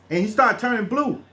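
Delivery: usual speaking voice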